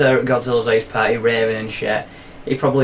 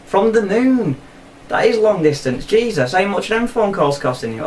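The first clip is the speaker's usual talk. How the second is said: acted astonishment; 7 semitones higher than usual. Acted phone call, astonished at distance.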